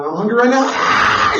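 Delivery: high, loud mock child yelling for food; screaming child. Mimicking a child demanding food, loud mock shout. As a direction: shout like a hungry toddler.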